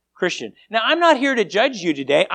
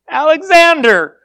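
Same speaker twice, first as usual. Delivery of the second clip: louder, higher answer called out; raised-voice reply, pushed out loud; stronger, raised voice; 9 semitones higher, 10 dB louder than usual. A narrator's reading. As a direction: speak up firmly like a soldier reporting. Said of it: "raised his voice a little stronger".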